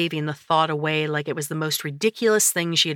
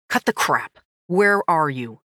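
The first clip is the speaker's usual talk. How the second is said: angry brother; 4 dB louder than usual. Acted. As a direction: cut her off harshly.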